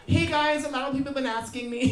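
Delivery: high, put-on vlogger voice; mocking high-pitched vlog intro; mock influencer. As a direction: imitate a self-important influencer. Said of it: "those that starts like this".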